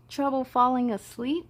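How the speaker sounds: usual speaking voice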